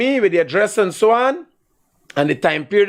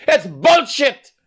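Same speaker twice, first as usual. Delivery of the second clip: higher and slow, spit it out in disgust; drawn-out contempt; angry, slow scorn; 8 semitones higher, much slower than usual. Real, not acted. Host dismisses caller's story.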